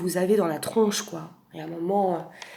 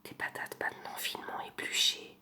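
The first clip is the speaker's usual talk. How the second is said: soft close-mic whisper, right in your ear; ASMR whisper; 3 semitones lower, 11 dB quieter than usual. Pretend. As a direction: breathe every word into the mic like an ASMR video. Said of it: "je vais vous faire une imitation".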